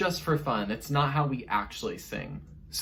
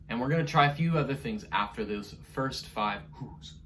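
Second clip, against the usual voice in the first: ordinary talk, nothing marked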